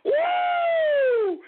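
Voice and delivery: high, loud celebratory woo; whoop. Real, not acted. Host to listeners celebrating, a loud whoop. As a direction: let out a victory whoop.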